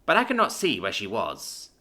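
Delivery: ordinary delivery, nothing marked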